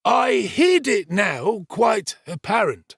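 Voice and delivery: slow, high pompous declaration; self-important storyteller Giles. Acted. Servant telling the kitchen what he heard, pompous declaration. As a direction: announce it grandly, word by word. Servant dramatising his tale.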